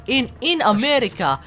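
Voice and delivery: put-on high, slow comedy Russian accent; mock Russian accent. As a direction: deliver the joke in a drawn-out Russian accent. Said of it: "in Soviet Russia".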